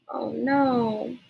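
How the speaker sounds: usual speaking voice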